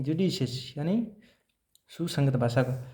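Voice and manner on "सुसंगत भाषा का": normal talking voice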